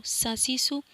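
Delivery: ordinary talk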